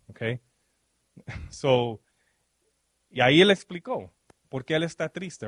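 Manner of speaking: ordinary delivery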